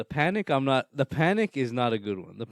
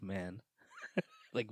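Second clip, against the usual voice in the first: ordinary delivery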